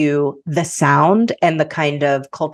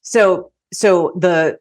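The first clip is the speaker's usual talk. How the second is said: usual speaking voice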